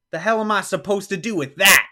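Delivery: loud, high outraged demand; retold yelling. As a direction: imitate a man yelling in disbelief. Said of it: "And he's yelling".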